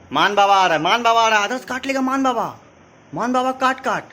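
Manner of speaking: high, loud pretend warning; playful mock alarm. Uncle scaring Adarsh with Maan Baba, mock alarm. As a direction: play-act a scare for a toddler, high.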